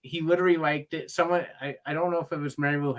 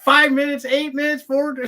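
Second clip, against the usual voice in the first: high, loud mock director counting down; hammed-up panicked director; mimicking Michael Caine; 13 semitones higher, 7 dB louder than usual. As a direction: squeal the countdown like a frantic director.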